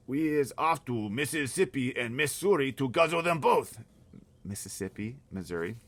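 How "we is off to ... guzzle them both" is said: loud, slow, higher yell; giants yelling. Acted. Giants shouting at the BFG as they gallop past, slow loud yell. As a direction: bellow it as the passing giants. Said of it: "they was yelling".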